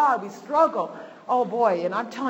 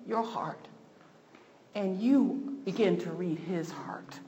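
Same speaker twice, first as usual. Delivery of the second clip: whisper; 2 semitones lower, 4 dB quieter than usual. Real, not acted. Intimate point about prayer.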